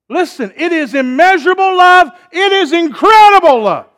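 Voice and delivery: loud, high, ringing pulpit preaching building to a shout; full-force preaching. Acted. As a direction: preach it out to the whole church, rising to a cry.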